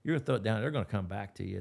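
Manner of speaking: ordinary delivery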